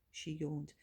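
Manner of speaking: plain reading voice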